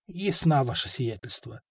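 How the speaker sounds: soft, sinking, dejected assent; crestfallen. A narrator's reading. Learned men submitting to the prince's task, crestfallen. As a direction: give in meekly, voice dropping away. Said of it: "понурились учёные-мужи".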